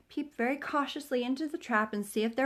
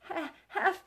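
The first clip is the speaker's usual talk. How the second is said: high breathless stammer; panting Piglet who can hardly speak; out of breath; 8 semitones higher, much slower than usual. A narrator's reading. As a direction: gasp the words out after running. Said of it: "breathing so hard that he could hardly speak".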